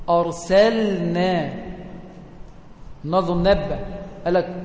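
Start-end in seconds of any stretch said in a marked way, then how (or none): 0.1-1.5 أرسلنا said slowly, the lam clearly sounded before the nun; correct clear lam. Shown as a demonstration. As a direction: draw out أرسلنا, lam kept apart from the nun. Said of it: "الصح إن أنت تقول إيه". (نطق أرسلنا ببطء مع إظهار اللام)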